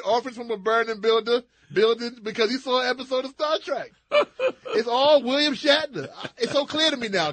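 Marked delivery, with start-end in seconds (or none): 4.8-7.3 loud, higher mock revelation; mock epiphany. Joking to co-host Curtis, a loud mock epiphany. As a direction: proclaim it like a hammed-up prophet.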